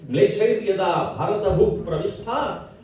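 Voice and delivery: lilting, measured chant of verse; Sanskrit verse recitation. Acted. Poet praising Bharat to a gathering, chanted recitation. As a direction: recite with drawn-out line endings.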